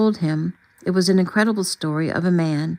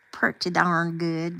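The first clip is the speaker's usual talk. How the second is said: playful touch of a Southern accent; 4 dB quieter, slower than usual. Shown as a demonstration. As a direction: slip into a light Southern accent. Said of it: "except a Southern accent. I can do that one".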